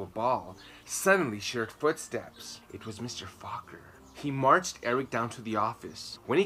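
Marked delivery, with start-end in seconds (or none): none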